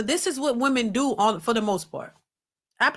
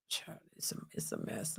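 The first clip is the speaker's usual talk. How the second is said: soft whispered sigh, much quieter; weary whisper; 19 dB quieter than usual. Real, not acted. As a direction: mutter it under your breath, shaking your head. Streamer's weary aside before moving on.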